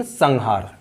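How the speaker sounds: "संहार" said as sang-haar, with an ng; slow demo of "संहार" with ng before the h; velar nasal. Shown as a demonstration. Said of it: "सटीक इसका उच्चारण है". ("संहार" में ङ की ध्वनि)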